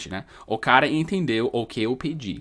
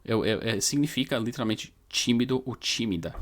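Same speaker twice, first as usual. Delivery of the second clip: ordinary talk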